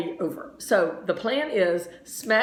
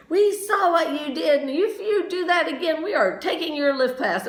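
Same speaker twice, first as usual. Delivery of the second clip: yelling; 7 semitones higher than usual. Retold or imitated. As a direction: tell her off like an angry ski patrol. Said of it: "they started yelling at me".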